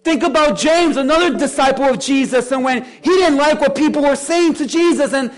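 loud, high, driving sermon delivery; full-force preaching. Real, not acted. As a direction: preach it at the top of your voice, leaning on key words.

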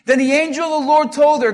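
ordinary talk

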